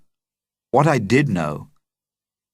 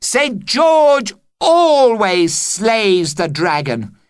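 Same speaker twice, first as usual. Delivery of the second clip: insistent; 11 semitones higher, 5 dB louder, much slower than usual. Acted. Boy insists on the story.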